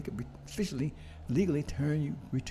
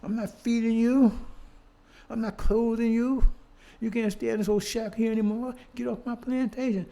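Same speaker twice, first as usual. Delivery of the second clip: higher, louder mock master shouting; imitating an angry master; 7 semitones higher, 5 dB louder than usual. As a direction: bark the order like the plantation boss. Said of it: "So master said".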